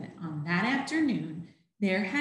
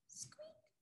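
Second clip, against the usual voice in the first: mouse squeak; 29 dB quieter than usual. Acted.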